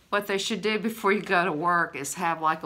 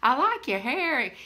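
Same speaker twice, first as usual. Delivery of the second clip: high, sing-song friendly compliments; butter up a grouch cheerily; imitated perky small talk; pleasant chat; 9 semitones higher than usual. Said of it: "talk to him just as pleasant".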